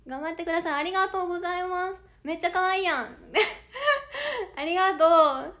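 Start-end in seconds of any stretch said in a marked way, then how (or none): 3.1-4.5 flustered laugh. Real, not acted. Flattered by a cute comment.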